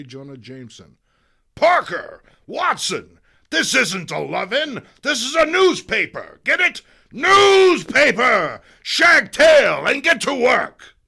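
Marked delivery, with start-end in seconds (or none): 1.5-10.9 high, loud yelling editor; furious newspaper boss shouting at staff; angry boss shout. Acted. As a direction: bellow at the reporters. Publisher barks at staff.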